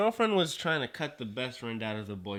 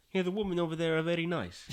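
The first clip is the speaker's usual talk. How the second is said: higher-pitched put-on Russian accent; 6 semitones higher than usual. Guest joking to host about moving to Russia, mock Russian accent. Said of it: "My Russian accent".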